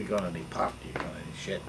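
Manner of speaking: low, hushed shakedown; officer sounding out the band, hushed; conspiratorial cop. Retold or imitated. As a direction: lean in and ask under your breath.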